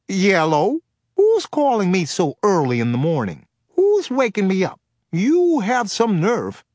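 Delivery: goofy high comic voice, slow at the end; Damon Wayans voice. Acted. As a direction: ham it up like a silly comedian for the kids. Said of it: "the slightly goofy Damon Wayans voice".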